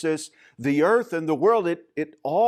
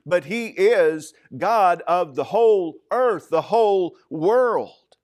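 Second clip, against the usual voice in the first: louder, higher, building to a slow WHOLE WORLD; emphatic climax; 3 semitones higher, 5 dB louder than usual. Real, not acted. Preacher telling congregation God isn't just Jerusalem's, emphatic climax. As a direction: punch "whole earth", then stretch "whole world".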